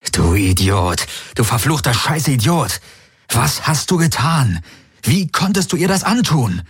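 rising, high cries of anger at himself; cursing himself in despair; self-loathing outburst. Acted. Galen beats his fists against his head, raging at himself.

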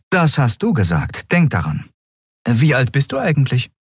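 lightly voiced captain, high; young captain. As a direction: break it to him gently. (Kapitänin, sachlich)

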